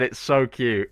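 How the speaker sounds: ordinary delivery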